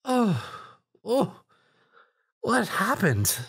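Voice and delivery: high, dazed wake-up; dazed woken voice. A narrator's reading. Rarity wakes confused, high. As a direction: come round confused. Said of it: "a feminine voice".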